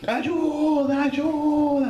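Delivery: high, drawn-out cry for help; shouted plea for help. Retold or imitated. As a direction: act out screaming to the distant shore. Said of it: "gritei assim". (grito encenado de socorro, agudo e prolongado)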